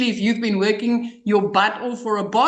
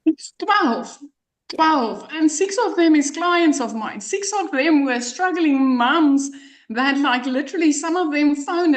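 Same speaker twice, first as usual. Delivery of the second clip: excited; 6 semitones higher than usual. Real, not acted. Moved by her clients' success.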